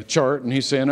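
ordinary talk

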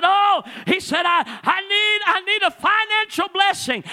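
high, loud hammed-up wail for a blessing; mock crying plea. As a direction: wail it like a man weeping at the altar. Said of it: "He's up there crying".

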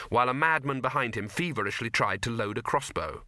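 ordinary delivery, nothing marked

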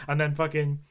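usual speaking voice

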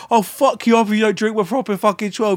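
hammed-up Irish trash talk; Dublin accent. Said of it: "Fantastic Dublin accent".

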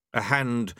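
ordinary delivery, nothing marked

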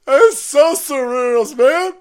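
slow, high, loud mock amazement; drawn-out mock awe. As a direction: stretch it out in fake wonder. Exaggerated awe.

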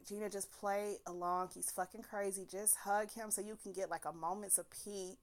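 soft, hushed self-advice; inner voice. Retold or imitated. Her inner voice urging her to play along, quiet. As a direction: murmur it like a voice in your head.

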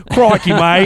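playful attempt at Aussie slang; mock Australian accent. Co-host echoing host's attempt, mock Australian. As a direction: put on a rough Australian accent. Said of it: "That's about my own".

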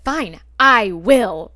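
slow, loud imitation of a stubborn boyfriend; drawn-out, emphatic quoted defiance; defiant retort. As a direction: take up the dare, stretching each word. Boyfriend's defiant answer, retold.

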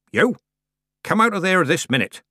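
high, loud commanding call; booming command. A narrator's reading. Granny commands the kitten out of the horn, booming. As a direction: order it out as if to shake mountains. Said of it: "Granny commanded, in a voice fit to shake mountains".

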